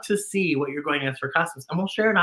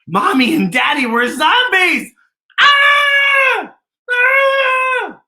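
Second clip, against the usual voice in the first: screaming; 5 dB louder, much slower than usual. Acted. Posy screams about zombie parents.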